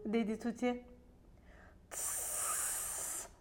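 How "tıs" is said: a voiceless snake hiss; a long sharp hiss. A narrator's reading. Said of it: "dedi çizgili bir yılan".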